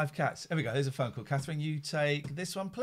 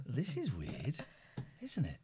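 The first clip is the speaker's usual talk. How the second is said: whisper; 9 dB quieter, slower than usual. Real, not acted. Whispering on a spooky call.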